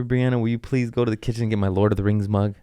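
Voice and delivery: normal talking voice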